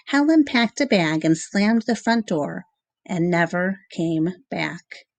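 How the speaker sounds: plain reading voice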